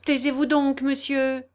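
high, loud reproof; scolding hush. A narrator's reading. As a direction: cut him off sharply to silence him. Janille hushes her master, nudging him.

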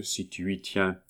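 soft, indulgent afterthought; mildly obliging. A narrator's reading. Neighbour indulging the young lover, mildly obliging. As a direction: let it drop away agreeably. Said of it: "dit complaisamment la voisine".

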